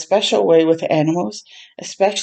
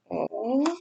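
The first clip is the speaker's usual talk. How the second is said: soft aw; 4 semitones higher, 11 dB quieter than usual. Real, not acted. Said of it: "moved reaction".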